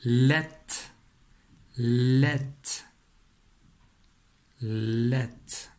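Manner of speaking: « let » articulé posément, répété comme une dictée; dictée de syllabe. Shown as a demonstration. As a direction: faire entendre « let » à l'élève, bien détaché. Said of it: "je voudrais que tu écrives la syllabe". (syllabe « let » dictée clairement)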